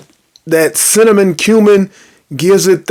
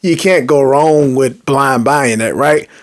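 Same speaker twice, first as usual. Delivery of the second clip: ordinary delivery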